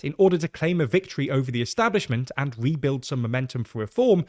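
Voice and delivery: normal talking voice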